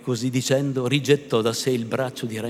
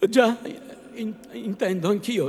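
1 agitated stammer; 6 semitones higher than usual. A narrator's reading. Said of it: "stravolgendo gli occhi e cambiandosi tutto in viso".

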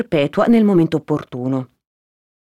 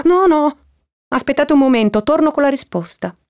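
high, louder protest; urgent relenting; 10 semitones higher, 3 dB louder than usual. Acted. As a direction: hurriedly keep them from leaving. Perpetua stops Toni from leaving. (concitato, a voce alta e acuta)

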